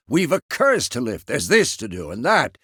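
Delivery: mock her brisk list of commands; indignant impression of someone giving orders; imitating a bossy woman. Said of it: "jumps right into a".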